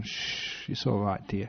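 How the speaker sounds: soft shushing, soothing a husband; calming hush. Retold or imitated. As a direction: hush him gently, like a wife calming him down. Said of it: "You calm them down".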